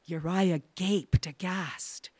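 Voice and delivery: usual speaking voice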